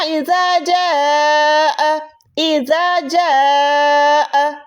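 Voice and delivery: इज़ा जाअ chanted very slowly; Quranic recitation. Shown as a demonstration. Teacher recites from Surah Nasr, slow chant. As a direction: recite like a reciter, long and even. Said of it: "सूरह नस्र में आता है ना".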